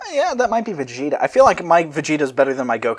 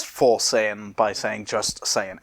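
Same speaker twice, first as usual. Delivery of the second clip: normal talking voice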